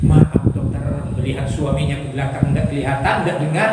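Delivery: low, confidential voice; apologetic wife. Acted. Wife making sure her husband cannot hear, hushed.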